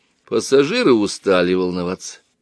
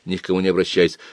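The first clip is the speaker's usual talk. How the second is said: ordinary delivery, nothing marked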